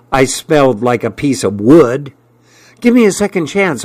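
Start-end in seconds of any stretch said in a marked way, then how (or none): 2.8-3.8 high, hurried plea; crying out. A narrator's reading. Boy begging the girl for a second chance, crying out. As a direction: cry out fast and high. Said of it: "I cried out".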